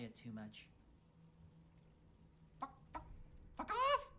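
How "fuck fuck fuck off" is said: high squawking chicken voice; annoyed chicken squawk. Shown as a demonstration. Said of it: "what sound does a chicken make".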